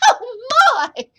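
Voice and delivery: put-on high, loud tiny old woman; high-pitched hammed-up shriek; startled old woman's cry. A narrator's reading. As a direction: cry out in fright as a little old lady. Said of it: "cried the tiny old woman".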